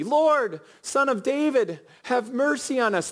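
high, pleading cry for mercy; shouted plea. Retold or imitated. Blind men crying out to Jesus over the crowd, pleading shout. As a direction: beg him to hear you over the crowd. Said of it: "they shouted it repeatedly".

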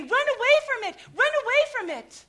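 high loud alarm; urgent cautionary cry; mock warning shout. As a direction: yell the warning like a street preacher. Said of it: "we're good at saying".

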